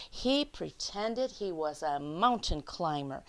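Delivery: lively picture-book read-aloud; reading to children. Acted. Teacher reading The Snowy Day to her class, lively read-aloud. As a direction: read it out like story time for little kids.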